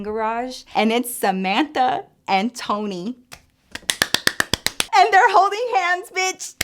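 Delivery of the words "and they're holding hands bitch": much higher, delighted gossip reveal; excited. Real, not acted. Telling viewers the couple walked by, high and thrilled. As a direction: squeal out the big reveal.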